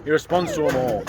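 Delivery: ordinary delivery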